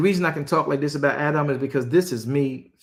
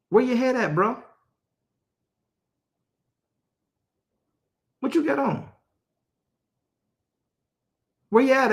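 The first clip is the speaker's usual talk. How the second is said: higher, pressing street-talk scolding; scolding God; 7 semitones higher, faster than usual. Acted. God scolds Adam for not meeting him, higher pressing scolding. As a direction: press him hard like a let-down friend.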